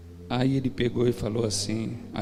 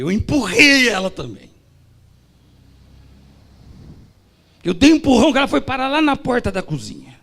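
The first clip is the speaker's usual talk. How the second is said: much higher and louder, quicker; loud confession; 10 semitones higher, 10 dB louder than usual. Retold or imitated. Deacon confessing to the church, loud and high. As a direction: blurt out the worst part loudly. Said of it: "eu vou ser sincero".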